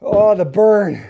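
slow, loud strained "oh, the burn"; strained groan. Real, not acted. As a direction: grunt it out through the burn. Mid-set muscle burn.

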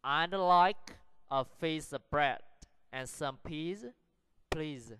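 careful phrase-by-phrase reading for learners; slow model sentence. Shown as a demonstration. Teacher models sentence six for practice, slow and deliberate. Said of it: "Practice the sentences".

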